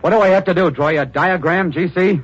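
high, loud, exasperated yell. Acted. Reporter yelling at his stubborn editor, exasperated. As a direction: shout it at him, fed up.

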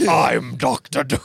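slow, cold villain voice; a hint of a menacing Doctor Doom; cold rasping evil emperor. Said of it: "I like my Doom voice".

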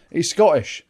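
normal talking voice

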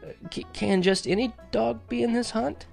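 high, halting croak. A narrator's reading. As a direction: croak it out haltingly, short of breath. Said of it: "I croaked".

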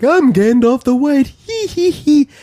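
high, silly wizard giggle; goofy Gandalf voice. Retold or imitated. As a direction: ham up a giggly old wizard. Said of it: "fucking Gandalf is like".

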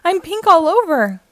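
high, loud wail of alarm; shouting. A narrator's reading. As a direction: shout it in storybook dismay. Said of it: "he shouts".

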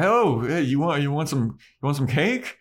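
high, overeager mock offer; hammed-up awkward fake cheer; acting weird. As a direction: sound suspiciously friendly, covering up. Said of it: "start to act weird".

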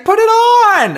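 high, loud excited yell; high energy shout. Retold or imitated. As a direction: hype it up like an MC. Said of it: "so high energy".

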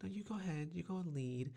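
soft polite urging. Retold or imitated. Hiker secretly avoiding spider webs sends others first, softly coaxing.

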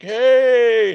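high, loud, stretched-out shout; slow motion. Retold or imitated. Grandpa chasing the turtle, high slow-motion yell. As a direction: drag the call out in mock slow motion. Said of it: "super slow".

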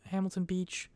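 normal talking voice

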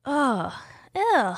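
high-pitched groan of distaste; disgusted. Real, not acted. Co-host hearing of a possible Misery sequel, disgusted. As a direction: recoil from a horrible idea.

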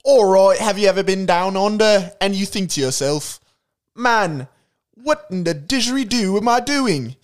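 broad Aussie pitchman; hammed-up Australian commercial voice; Australian accent. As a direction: put on an Aussie accent for a mock ad.

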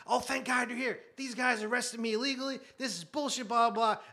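high-pitched mock indignation; imitated frantic complainer. Caught shoplifter complaining to arriving cops, mock frantic. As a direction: imitate an outraged suspect rattling off complaints.

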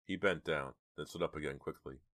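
usual speaking voice

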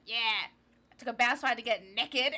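loud, quick Louisiana twang; Louisiana accent. Retold or imitated. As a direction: imitate a Louisiana girl saying "nekkid". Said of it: "she was from Louisiana".

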